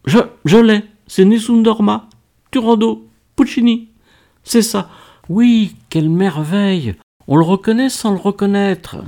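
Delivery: high, thrilled exclamation; high-pitched eureka outburst; excited cry. A narrator's reading. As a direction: cry out in delighted recognition. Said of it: "Laure poussa un cri".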